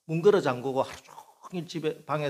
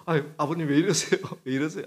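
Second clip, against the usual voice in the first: higher, louder flustered officer; flustered policeman; 3 semitones higher, 5 dB louder, faster than usual. Retold or imitated. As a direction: fuss over the father, embarrassed. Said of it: "막 당황스러운 거예요".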